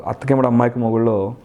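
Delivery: ordinary talk, nothing marked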